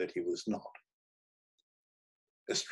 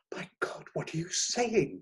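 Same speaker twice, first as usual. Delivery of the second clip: hushed gasp rising into a high cry; high-pitched, shocked question; 8 semitones higher than usual. A narrator's reading. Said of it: "cried the girl".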